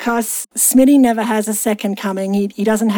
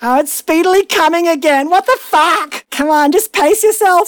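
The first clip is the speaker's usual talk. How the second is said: loud, high, exasperated outburst; mock exasperation; 7 semitones higher, 5 dB louder than usual. Real, not acted. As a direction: protest loudly in comic disbelief. Mock outrage at the verse.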